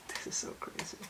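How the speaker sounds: breathy whisper. Real, not acted. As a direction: whisper it to the camera. Whispered aside.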